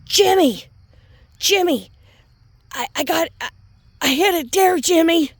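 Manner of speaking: high, loud panicked wife calling Jimmy; mocking a panicked mom on a live video, high and loud; frantic mommy voice. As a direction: mimic a frantic mom wailing for her husband. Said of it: "when I'm like".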